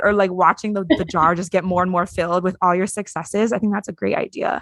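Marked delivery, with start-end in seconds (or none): none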